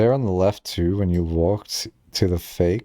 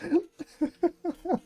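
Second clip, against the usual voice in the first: high giggle; 7 dB quieter than usual. Real, not acted. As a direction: burst of high breathy giggling. Streamer bursts out laughing at clip.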